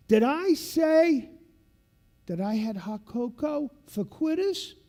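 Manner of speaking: loud, very slow rhetorical question; retold instructor's slow emphasis; slow pointed shout. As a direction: drive the lesson home. Instructor lecturing class.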